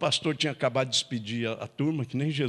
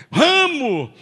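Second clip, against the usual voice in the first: put-on much higher, loud drunken shout; drunk yell; 14 semitones higher, 12 dB louder than usual. Drunk husband yelling at his wife to open, loud. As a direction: bellow it like a drunk pounding the door.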